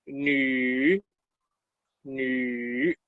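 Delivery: "nu" drawn out low and slow; teacher's long, careful "nu"; pronunciation model. Shown as a demonstration. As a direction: stretch the vowel of "nu" for learners. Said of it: "Se pronuncia".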